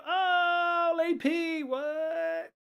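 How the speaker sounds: high, startled call to Lady P; surprised oh. Real, not acted. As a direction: caught off guard by a chat message, voice jumps up. Surprised by moderator's chat message.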